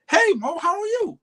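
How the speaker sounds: mimicking her high upbeat hello; high excited greeting. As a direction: voice jumps way up, bright and eager. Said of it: "how you would raise your voice to match".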